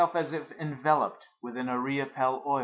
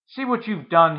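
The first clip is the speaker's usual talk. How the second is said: angry shout, much higher and louder; father shouting; 7 semitones higher, 11 dB louder than usual. Acted. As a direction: blame the mother at full voice. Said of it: "he shouted".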